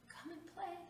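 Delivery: high, gentle tree voice asking to play; tree's high invitation. Acted. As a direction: coax him like a hopeful old tree. Tree invites old boy.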